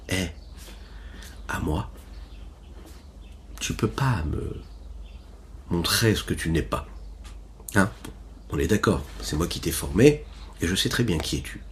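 soft and close, with a kind smile; gentle whisper in the ear. A narrator's reading. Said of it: "certainement avec un gentil sourire dans l'oreille". (chuchoté gentiment à l'oreille, avec un sourire)

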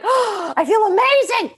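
high, elated gasp and exclamation; joyful outburst. Retold or imitated. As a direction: burst out with big joy. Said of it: "this big expression of, of emotion".

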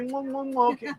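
soft high kissing sounds into the mic; blown kisses. Real, not acted. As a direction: blow them a few quick kisses. Blowing kisses to the stream.

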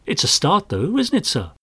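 high, bright rising question; cheery. Acted. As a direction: chirp it up hopefully. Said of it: "Graves threw him a cheery look".

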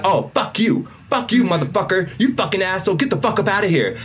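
high-pitched mock cursing tirade; retold angry cussing. Man cussing at her in front of his kids, retold angrily. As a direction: imitate someone hurling insults, pitch pushed up. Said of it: "cussing at me".

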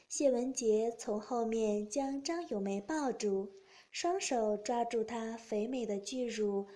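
plain reading voice

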